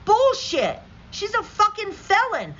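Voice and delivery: high, loud furious shout; angry outburst. Real, not acted. Reacting to Trump's praise, a high furious yell. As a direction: explode at the claim.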